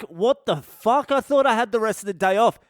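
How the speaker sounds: retold outburst, pitched well up; indignant protest. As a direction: protest like a cheated schoolkid. Acted protest at returning to school.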